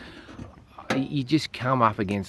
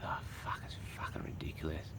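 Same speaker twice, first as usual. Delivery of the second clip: whispered and much softer, fed up; defeated courtroom grumble, whispered; muttered whisper; 6 semitones lower, 12 dB quieter than usual. Pretend. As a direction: mutter it under your breath in disgust. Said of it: "Me just fucking going".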